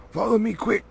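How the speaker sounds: urgent, high, loud hiss of a command; fierce urgent call; fierce and quiet. A narrator's reading. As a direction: snap it out to hurry them. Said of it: "fierce and quiet".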